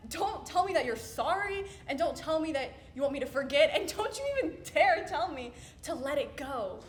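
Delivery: indignant, emphatic rebuke with swooping pitch; hurt confrontation. Acted. Confronting someone who says sorry, hurt and indignant. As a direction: stop them leaving, stressing each refusal.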